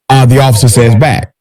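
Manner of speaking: ordinary delivery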